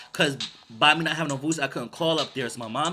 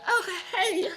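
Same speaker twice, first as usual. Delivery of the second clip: very high, slow, falsetto greeting; nervous lady voice. Retold or imitated. Scared ex-coworker greeting him in the store, high falsetto. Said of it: "I should do that voice".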